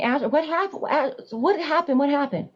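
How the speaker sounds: high, unraised imitation of screaming; mild re-enacted hysterical pleading; retold scream. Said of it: "I'm screaming".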